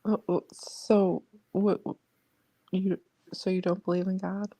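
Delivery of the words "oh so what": ordinary talk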